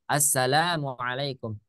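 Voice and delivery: "Assalamualaikum" with the la drawn out slowly; long la in greeting. Shown as a demonstration. Teacher showing students the long la in "Assalamualaikum". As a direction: stretch the la of "Assalamualaikum" two beats. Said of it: "lanya dibaca panjang".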